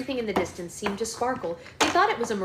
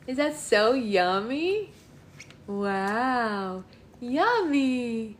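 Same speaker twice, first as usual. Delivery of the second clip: ordinary delivery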